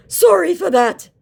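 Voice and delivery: high, slow mocking voice for the scammer; sneering impression of a fake scammer. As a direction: play the scammer as a silly high whine. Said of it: "said my scammer".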